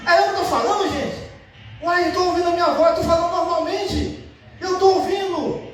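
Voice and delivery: high-pitched, acted astonishment; amazed healed man. Healed man tells the people he can hear, amazed. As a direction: marvel in a high voice at hearing yourself. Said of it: "Aí imagina ele".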